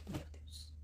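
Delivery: breathless awed whisper. Real, not acted. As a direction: whisper it in awe. Awed reaction after passage.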